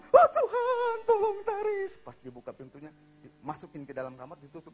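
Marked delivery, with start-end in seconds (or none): 0.0-1.9 piercingly high, loud wail; child screaming for help. Retold or imitated. Boy about to be locked up, wailing scream. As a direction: scream to heaven for rescue. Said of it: "dia teriak".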